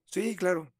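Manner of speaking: high, breezy voice for the cop; a hint of an easygoing policeman; cop's reply. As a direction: answer like a friendly cop.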